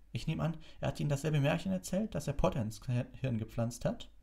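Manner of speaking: high-pitched, contemptuous needling; snarling. A narrator's reading. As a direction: sneer at a colleague with a touch of snarl. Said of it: "fauchte Snape". (verächtlich fauchend)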